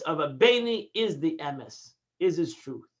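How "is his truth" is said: normal talking voice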